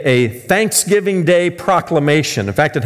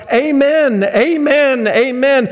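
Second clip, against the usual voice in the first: much higher, urgent repeated amen; panicked shout; 10 semitones higher, slower than usual. Retold or imitated. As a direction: cry out in panic before the cliff. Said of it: "the guy panics and he shouts".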